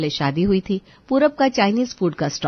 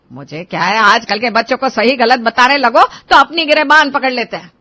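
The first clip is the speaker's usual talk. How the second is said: loud sarcastic scolding; angry mother; 5 semitones higher, 8 dB louder than usual. Acted. Mother grumbling at her son while leaving, loud sarcasm. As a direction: fling sarcasm at him as she storms off.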